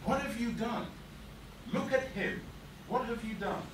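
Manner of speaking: high-pitched, horrified questioning; appalled reproach. Acted. Woman to the boy over his hurt victim, appalled. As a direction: confront them with what they did.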